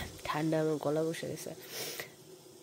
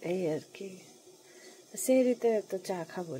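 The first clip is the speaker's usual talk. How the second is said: normal talking voice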